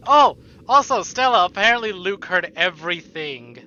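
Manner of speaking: sudden excited exclamation; excited outburst. Real, not acted. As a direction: blurt it out in sudden realisation. Excited realisation to chat.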